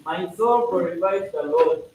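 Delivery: slow, solemn recited prayer; prayer recitation. Acted. Leader reciting the catena to members, slow and solemn.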